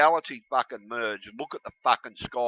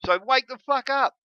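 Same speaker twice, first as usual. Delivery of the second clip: pitched way up, loud, shouting at viewers; angry shout; 12 semitones higher, 5 dB louder than usual. Real, not acted. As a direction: yell it at the whole audience. Ranting at Christians to wake up.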